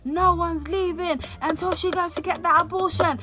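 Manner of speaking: retold angry shouting. Boyfriend ordering everyone to stay in the house, retold as shouting. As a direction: imitate a boyfriend yelling an order at the room. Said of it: "he's shouting, screaming".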